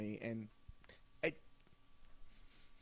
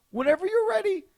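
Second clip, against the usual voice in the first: a much higher, louder impatient clerk; 6 dB louder than usual. Retold or imitated. Clerk hurrying the customer, high impatient voice. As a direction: prod him in a high sing-song. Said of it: "She goes".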